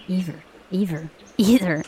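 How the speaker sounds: trying out "Either" over and over; "Either" said again and again, testing the sound; repeating either. Shown as a demonstration. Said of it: "Either, silly".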